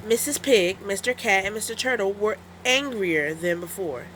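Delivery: ordinary delivery, nothing marked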